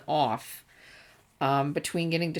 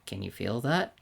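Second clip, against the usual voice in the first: put-on lowered voice of a stern old ninja; 8 semitones lower, 4 dB quieter than usual. Tobirama turns to the water.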